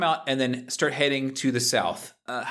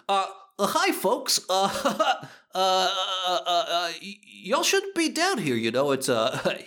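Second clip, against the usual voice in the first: high, stammering foreman voice, much higher; a flustered site boss stumbling over his words; nervous foreman; 6 semitones higher than usual. Acted. GM playing a flustered foreman who tells the party to leave.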